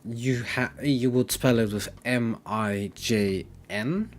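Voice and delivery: ordinary talk, nothing marked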